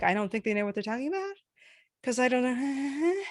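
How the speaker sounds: unsure upspeak, "about" and "know" lifted; upspeak imitation. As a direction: mimic someone doubtful, drawing "know" upward. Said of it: "always go up at the end".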